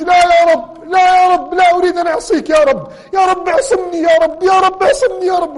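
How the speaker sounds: acted-out anguished shout of no Lord; retold desperate cry. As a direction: cry out the refusal to heaven. Youth refusing sin, crying to God.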